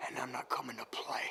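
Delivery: low threatening whisper; menacing whisper. Acted. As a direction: breathe the threat quietly.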